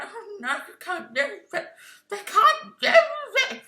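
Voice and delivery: high, whiny, crying complaint; mock a fan whining about Naga. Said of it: "Be like".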